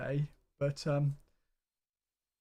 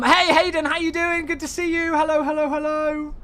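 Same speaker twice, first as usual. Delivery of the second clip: much higher and louder, bouncy hellos; excited greeting; 11 semitones higher, 7 dB louder than usual. Real, not acted. Streamer welcomes Hayden in chat, loud excited greeting. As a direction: greet a friend arriving in chat with a big cheer.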